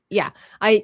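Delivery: ordinary delivery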